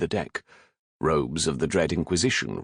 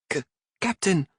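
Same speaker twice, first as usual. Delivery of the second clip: high, frightened stuttering call; a scared boy catching his breath; choked cry; 13 semitones higher than usual. Acted. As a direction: cabin boy crying out to his captain in fear. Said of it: "the sound of a choked voice".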